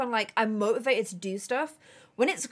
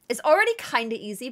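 usual speaking voice

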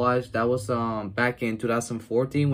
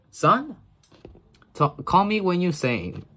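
character voice; 6 semitones higher than usual. Retold or imitated.

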